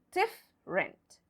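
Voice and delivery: different in two syllables, DIF-rent; correct silent-E pronunciation. Shown as a demonstration. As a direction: drop the middle E of different. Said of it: "We pronounce it".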